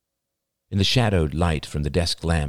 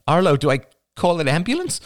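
high, fast, urgent plea; panicked question; 11 semitones higher, 5 dB louder, faster than usual. A narrator's reading. Girlfriend asking her gasping boyfriend about an ambulance, panicked. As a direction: ask it fast and high, alarmed.

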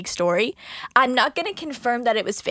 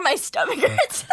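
high, giggle-broken words; real cracking up; laughing while talking; 8 semitones higher, slower than usual. Real, not acted. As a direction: break into laughter mid-phrase.

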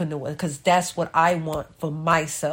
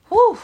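relieved whew; 7 dB louder than usual. Real, not acted. Relief after the call.

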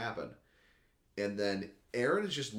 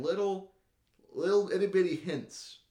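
high-pitched, louder itty-bitty voice; playful tiny voice; 9 semitones higher, 4 dB louder than usual. Real, not acted. Playfully miming how tiny hints are.

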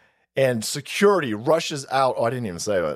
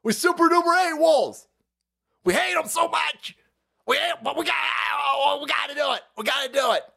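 much higher, whiny mock voice; mock Reddit voice; 9 semitones higher than usual. Mocking angry Reddit users, high and whiny. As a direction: wail it like an overwrought leftist.

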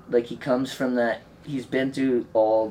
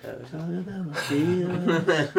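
mocking; 6 semitones higher, slower than usual. Mocking a friend's line.

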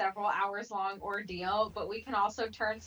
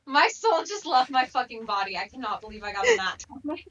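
loud, fast, thrilled; excited disbelief; 3 semitones higher, 8 dB louder than usual. Real, not acted. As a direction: blurt it out in shock. Shocked at nat twenty.

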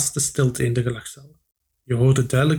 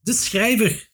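higher, breathless gasp of news; panting; 8 semitones higher, slower than usual. A narrator's reading. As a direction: blurt it out of breath. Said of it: "hijgde de jongeman".

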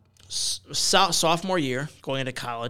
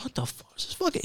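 alarmed; 12 semitones higher, 6 dB quieter than usual. Retold or imitated. Retelling his panic after the hit.

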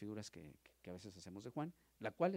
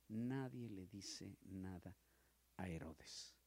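slow, deliberate repetition; drawn out for weight; slow emphasis; 4 semitones lower, 5 dB quieter, much slower than usual. Acted. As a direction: lay each word down slowly. Repeating the point slowly.

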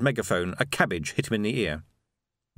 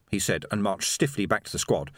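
usual speaking voice

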